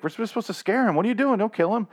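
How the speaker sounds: high, frantic pleading; yelping in alarm to stop the killing; panicked Vincent. Retold or imitated. Vincent pleading with his partner to stop, high and frantic. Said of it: "Vincent's like".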